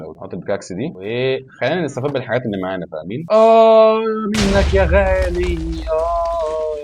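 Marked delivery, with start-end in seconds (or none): 3.3-6.8 high, loud, slow drawn-out groan; mock groan. Real, not acted. Gamer groaning after a hit, drawn-out moan. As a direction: moan it out theatrically.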